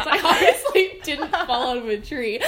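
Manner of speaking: loud, amused protest through laughter; laughing. Real, not acted. Admitting the tree story was false, laughing. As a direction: laugh while insisting on the obvious, voice rising. Laughing at own fake excuse.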